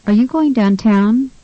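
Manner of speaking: "downtown" stressed on TOWN, rising; second-syllable stress. Shown as a demonstration. Tutor models a dialogue question, stress on TOWN in "downtown". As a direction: ask it with the weight on the end of "downtown". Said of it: "use the correct stress".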